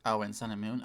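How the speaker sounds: usual speaking voice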